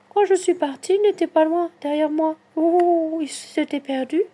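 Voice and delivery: voix haut perchée, inquiète; a worried, trembling character, pitched high; tremblante. A narrator's reading. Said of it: "dit-elle, en tremblant". (toute tremblante, aiguë)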